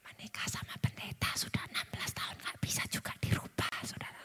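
playful confiding whisper; soft conspiratorial whisper to the crowd; stage whisper. Pretend. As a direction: whisper it like a secret the husband mustn't hear. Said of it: "saya pelan-pelan ngomongnya".

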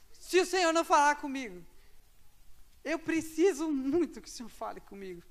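high, loud begging prayer; loud desperate plea. Retold or imitated. Student pleading loudly with God to speak. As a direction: beg God with everything you have.

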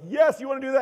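high, loud, eager shout; excited hype. Acted. Jesus building the crowd up toward mountain-moving faith, excited shout. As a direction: fire them up with a big "yes". Acting out Jesus hyping people up.

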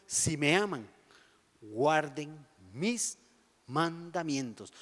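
drawn out, very slow and soft; a solemn, deliberate reading of the command; slow emphatic quote. Retold or imitated.

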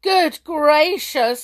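loud, slow, high exclamation; terribly upset. A narrator's reading. King learning who they are, terribly upset. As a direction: stretch it out in shocked dismay. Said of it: "in a terrible upset".

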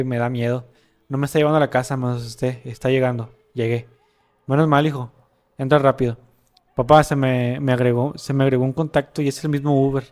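the son texting his dad from a disco, read out loudly; dramatic story reading. Acted. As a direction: read the chat story aloud with energy. Reading chat story aloud.

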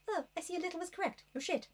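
a sneering put-down, lightly voiced; mock haughty Rochester. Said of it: "and he's like".

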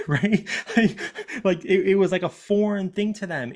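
amused, high-pitched laughing aside. Real, not acted. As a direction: let real amusement break into the speech. Amused at own exaggerated comparison.

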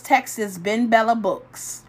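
ordinary talk

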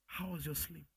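quiet caring father asking; tender check-in after sleep; softly spoken God; God's gentle voice. Acted. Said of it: "you hear him talk again".

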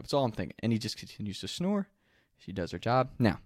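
ordinary talk, nothing marked

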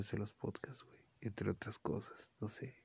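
soft unvoiced whisper. Real, not acted. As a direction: murmur it as a secret aside. Aside to listeners.

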